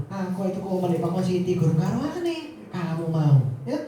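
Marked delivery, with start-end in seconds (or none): none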